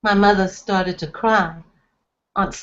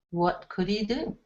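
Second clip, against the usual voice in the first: ordinary delivery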